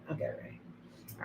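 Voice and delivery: slow whisper to herself; slow private whisper. Real, not acted. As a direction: whisper the promise slowly under her breath. Repeats a promise, then whispers it.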